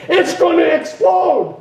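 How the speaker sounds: high, drawn-out warning cry; mock-panicked shout, pitched high; retold warning yell. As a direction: yell a slow, urgent warning. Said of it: "yell and say".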